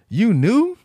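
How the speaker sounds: high, loud incredulous question; retold astonishment. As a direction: imitate someone shocked to find out. Retells his surprise at caterers.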